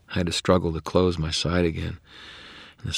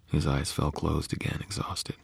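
plain reading voice

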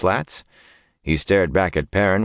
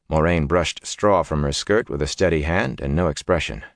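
plain reading voice

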